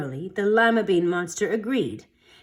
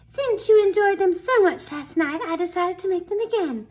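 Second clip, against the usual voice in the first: cheerful mom voice, raised pitch; happy mom; 10 semitones higher than usual. A narrator's reading. Mom to Sammy, serving beans again, cheerfully high. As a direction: sing it out like a proud mom serving dinner. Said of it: "Mom said happily".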